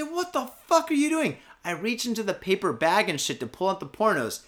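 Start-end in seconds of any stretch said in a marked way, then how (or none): 0.0-1.4 high-pitched, incredulous telling-off; retold indignant challenge. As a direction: act out confronting a thief at the register. Confronts the thief at register.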